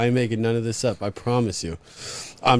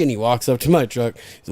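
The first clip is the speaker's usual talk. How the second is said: usual speaking voice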